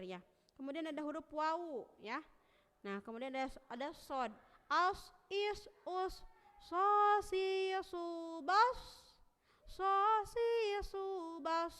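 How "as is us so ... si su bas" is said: "as, is, us, bas" higher and louder, hissing on; sod recitation. Shown as a demonstration. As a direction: hiss each s long. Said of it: "mengalir atau lembut".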